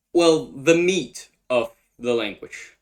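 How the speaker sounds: ordinary delivery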